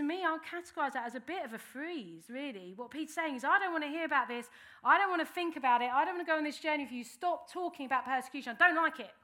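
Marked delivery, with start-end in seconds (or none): 3.4-9.1 louder, insistent refusal; stubborn protest. Retold or imitated. Peter refusing to hear about persecution, loud stubborn protest. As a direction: push the words away, digging in.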